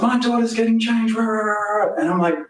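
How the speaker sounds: high, pushed yelling voice; mock angry neighbour. Neighbour explaining why he's angry, mock high yell. Neighbour's claim, incredulous reply.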